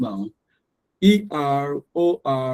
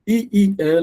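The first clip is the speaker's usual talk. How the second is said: normal talking voice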